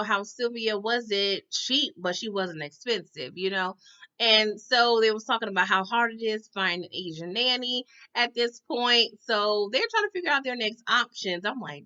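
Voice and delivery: ordinary talk, nothing marked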